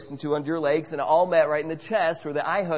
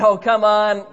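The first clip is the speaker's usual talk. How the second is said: loud, higher coaxing; goading; 5 semitones higher, 8 dB louder, slower than usual. Retold or imitated. Crazy guy goading him after a refusal, loud coaxing. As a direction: imitate a friend egging someone on loudly.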